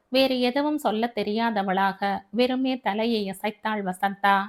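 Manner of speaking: ordinary delivery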